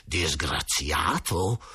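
low, slow, seething; through clenched teeth. A narrator's reading. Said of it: "esclamò a denti stretti".

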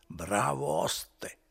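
put-on slow, slurred drunk praise; tipsy, slowed-down praise; drunken slur. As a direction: drag the words out like a drunk man. Drunk Renzo praises the innkeeper.

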